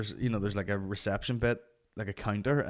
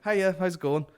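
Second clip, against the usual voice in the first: high, louder friendly greeting; over-friendly hello, pitched up; overcompensating nice; 8 semitones higher, 5 dB louder than usual. Retold or imitated. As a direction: greet a stranger extra nicely. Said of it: "overcompensate with the nice".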